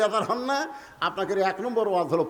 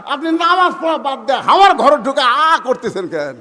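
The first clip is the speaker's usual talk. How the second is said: raised, indignant complaint to father; son's loud protest; 5 semitones higher, 7 dB louder than usual. Acted. Son objects to father barging into his room, loud protest. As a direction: protest loudly, dragging out the end.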